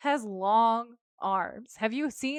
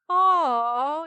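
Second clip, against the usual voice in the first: mock swooning aw; 7 semitones higher, 9 dB louder than usual. Host gushing over a sweet moment.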